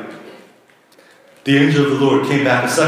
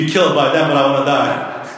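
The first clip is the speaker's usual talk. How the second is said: normal talking voice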